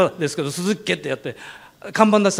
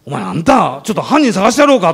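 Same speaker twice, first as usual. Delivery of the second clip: fired up, itching to find the culprit; worked-up cop; 6 dB louder than usual. Retold or imitated. Policeman outraged on the speaker's behalf, imitated excited shout. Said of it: "だんだんお巡りさんの方が興奮してきて".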